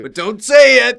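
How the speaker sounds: loud high protest; shouting. Real, not acted. Playful outburst mid-chat.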